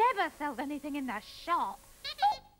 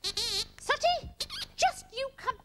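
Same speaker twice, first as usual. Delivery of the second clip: high, indignant telling-off; angry scolding; 6 semitones higher than usual. Acted. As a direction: threaten him through a closed door, pitched high. Scolds Sooty through bathroom door.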